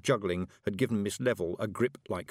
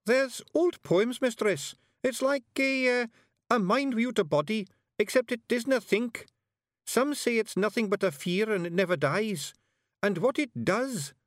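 solemn Feegle; 13 semitones higher, 4 dB louder than usual. A narrator's reading. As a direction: tell it like an old tale. Billy explains hiver.

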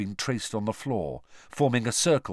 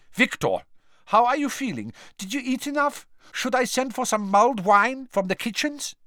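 high, doting headmaster voice; fussing Karkaroff; 13 semitones higher than usual. A narrator's reading. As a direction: fuss over him like a doting father. Karkaroff fussing over Krum.

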